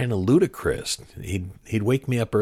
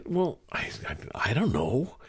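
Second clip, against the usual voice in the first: higher, halting mock reluctance; unsure, softer high-pitched start; retold flustered hesitation; 4 semitones higher, 5 dB quieter than usual. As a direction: stammer lightly like a kid put on the spot. Flustered boy's reply retold.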